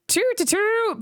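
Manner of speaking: high tooting bellow; mock bellow. A narrator's reading. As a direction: honk it out. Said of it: "bellowed Bertie".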